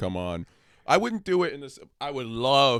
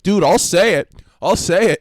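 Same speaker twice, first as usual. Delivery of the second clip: loud, higher-pitched quote; volunteer it loudly, pitched up; eager volunteering; 6 semitones higher, 10 dB louder than usual. Retold or imitated. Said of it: "he's like".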